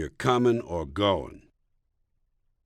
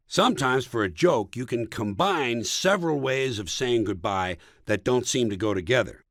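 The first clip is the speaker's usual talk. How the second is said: ordinary talk, nothing marked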